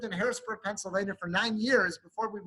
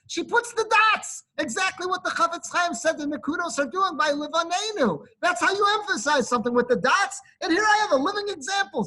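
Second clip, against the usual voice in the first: louder, impassioned sermon voice, pressing every blessing home; fervent preaching; 5 semitones higher, 4 dB louder than usual. Acted. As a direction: preach it with rising fervour. Rabbi's sermon rises to full force.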